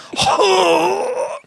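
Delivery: sharp high gasp; shocked gasp. Retold or imitated. Friend's shocked reaction, retold.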